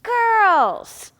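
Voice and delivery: high, loud rallying shout; loud call. Shown as a demonstration. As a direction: call out to the whole group. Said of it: "as loud as you can".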